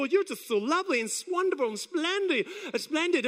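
high-pitched fawning welcome; mock adoring party guests; gushing admirers. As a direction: gush with rising delight. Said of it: "And they go".

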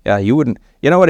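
ordinary delivery

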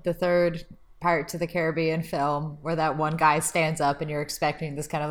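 usual speaking voice

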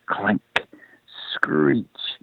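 soft, hushed, suspenseful noise words; whispered sound words. A narrator's reading. Rider clanking closer to terrified rabbits, ominous whisper. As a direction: let each sound hang in a hush. Narrator repeats the approaching metallic noises.

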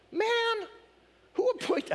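very high, shocked exclamation; shocked Jesus. Retold or imitated. Jesus answering the man's demand, shocked high voice. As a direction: squeak it out in mock disbelief. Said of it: "That's my Jesus shocked voice".

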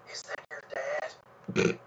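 hushed aside whisper; whispered joke to a friend. Acted. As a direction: lean in and whisper it. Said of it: "just whisper".

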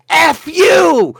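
very high, loud holler; distant shouted insult. Retold or imitated. Man on an island insults a stranger, hollering. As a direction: yell it across the lake. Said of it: "on an island some ways away".

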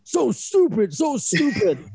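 high mock self-scolding. Host mocking his own nervous question, high and mocking. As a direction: scold yourself in a squeaky voice. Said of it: "I get nervous and I'm like".

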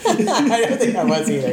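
high-pitched, laughing admission. Real, not acted. Conceding to a friend about being sober, laughing. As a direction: let the laugh lift the words.

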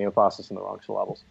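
"emphasis" and "syllables" stressed on the second syllable; movie-quote misstressing of "emphasis" and "syllables", second syllables hit; misplaced stress. Retold or imitated. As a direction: say "emphasis" as em-FASS-is, "syllables" as sil-LAB-les. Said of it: "I think it's with Mike Myers".

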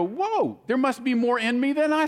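high, amazed whoa; pleasant surprise. Retold or imitated. Reacting to a hard temptation with high, amazed delight. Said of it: "you go".

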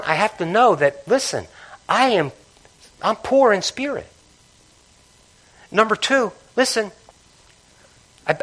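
full-throated pulpit crescendo; climactic preaching. Acted. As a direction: build the beatitudes to a peak.

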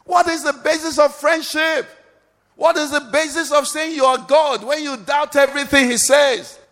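high-pitched, urgent exhortation; impassioned pulpit appeal; full-force preaching. Real, not acted. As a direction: urge the congregation to believe right now.

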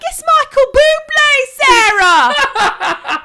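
high, loud, drawn-out mock scream; shocked shriek. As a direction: imitate outraged co-workers shrieking at a non-fan. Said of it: "They were, like, shocked".

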